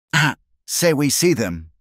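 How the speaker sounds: put-on high bright Mexican-accented exclamation; sudden lively exclaim in a Mexican accent. A narrator's reading. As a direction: burst out as if remembering. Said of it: "the trader exclaimed".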